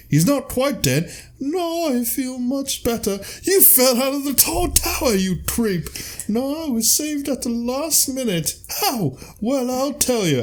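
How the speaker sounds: very high, slow Python falsetto; squeaky prince voice. Retold or imitated. The prince telling the guests he is alive, high falsetto. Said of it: "he's just like".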